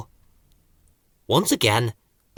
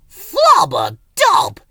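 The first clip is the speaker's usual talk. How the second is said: very high, slow squeaky protest; indignant gibberish; 7 dB louder, much slower than usual. A narrator's reading. Ben denying Thistle's accusation, high indignant gibberish. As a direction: protest hotly. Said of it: "said Ben indignantly".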